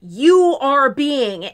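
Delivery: loud, slow, domineering accusation; aggressive tone. Retold or imitated. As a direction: imitate a bullying salesman, leaning hard on each word. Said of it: "this aggressive tone of".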